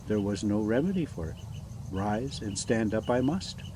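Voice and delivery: ordinary delivery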